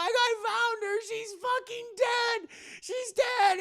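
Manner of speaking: high-pitched mock wail; calling out. Maid calls out on finding Mina, high mock scream. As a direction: shriek it from afar in hammed-up horror. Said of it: "another maid from outside is calling".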